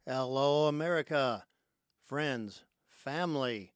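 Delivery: loud, punchy welcome, word by word; big show-opening greeting. Real, not acted. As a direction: hail the whole audience loudly. Opening greeting to radio audience.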